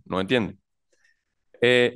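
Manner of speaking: usual speaking voice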